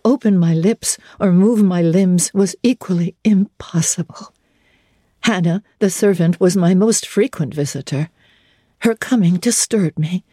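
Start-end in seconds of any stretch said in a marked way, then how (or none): none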